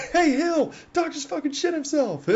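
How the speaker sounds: high, laughing mock holler; imitated yell. Gunner yells the news on to another, high mock holler. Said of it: "the gunners are just yelling at each other".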